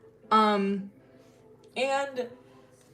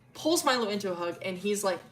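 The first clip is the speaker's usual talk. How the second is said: ordinary talk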